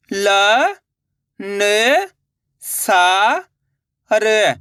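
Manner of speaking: ल, न, सा, र drawn out with gaps; syllable by syllable. Shown as a demonstration. Teacher breaking a word for learners, syllable by syllable. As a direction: sound out ल, न, सा, र singly. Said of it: "एक अक्षर का उच्चारण करके".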